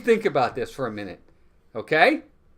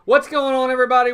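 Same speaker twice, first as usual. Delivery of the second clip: excited greeting; 7 semitones higher, 6 dB louder, faster than usual. Real, not acted. Stream opening greeting.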